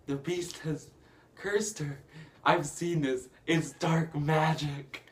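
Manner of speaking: hammed-up, building to a drawn-out finish; mock dramatic accusation. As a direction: imitate a villain warning a crowd. Retold Gaston accusing before the mob.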